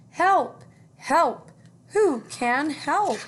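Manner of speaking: high, loud desperate cries for help; duck crying for help. Acted. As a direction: call out like a panicking stuck duck. Duck calling for help in story.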